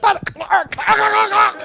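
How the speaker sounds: imitated buzzer firing over and over; toy voice. Comic mimics Bop It ten seconds in, high frantic buzzing. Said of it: "you're like".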